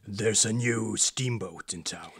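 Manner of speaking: deep, hushed movie trailer narrator; low, quiet trailer announcer. Shown as a demonstration. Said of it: "Or, or my trailer voice".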